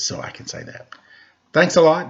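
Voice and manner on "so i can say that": conspiratorial whisper; whispered aside. Real, not acted. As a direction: whisper it as a secret joke. Joking aside after laugh.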